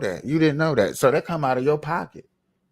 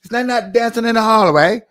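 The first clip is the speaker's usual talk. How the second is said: loud, higher mock British accent on the question; hammed-up British bloke asking; 8 semitones higher, 7 dB louder than usual. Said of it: "let me put on my British voice".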